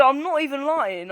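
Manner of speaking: ordinary talk, nothing marked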